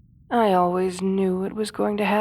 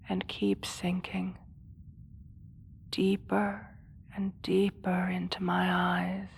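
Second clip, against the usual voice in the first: hypnotic whisper. Acted. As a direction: draw them in, whispering. Hypnotic seduction of guard.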